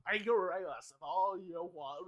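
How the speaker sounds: loud, hammed-up garbled talk; mock your own speech falling apart; exaggerated mangled words, loud; garbled future speech. Shown as a demonstration. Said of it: "my speech one day is literally gonna be like this".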